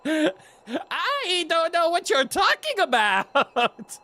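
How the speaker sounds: very high and very slow, laughing through it; giggly high mock-denial; laughing high Gorb voice. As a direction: feign innocence in the high silly voice. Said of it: "you can't do that voice as you take a drink".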